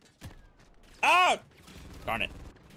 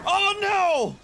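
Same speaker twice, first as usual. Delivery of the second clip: sudden high yelp of alarm; 13 semitones higher, 4 dB louder than usual. Real, not acted. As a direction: caught off guard, cry out. Game airstrike, sudden alarm.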